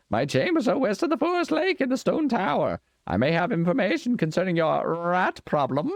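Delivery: put-on high, cracked old man voice; crazy old man voice. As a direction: play a wild old wizard. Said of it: "my usual crazy old man voice".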